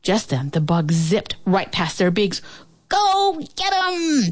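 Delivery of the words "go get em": high, drawn-out crow cry; all cheering on. A narrator's reading. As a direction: urge him on, stretching each word. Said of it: "they all said together".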